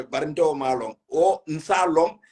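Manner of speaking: normal talking voice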